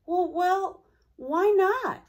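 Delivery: high, bright little-car voice asking; a small streetcar protesting up high; eager young voice. Acted. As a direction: pipe it up, hopeful and a bit hurt. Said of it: "asked Charlie".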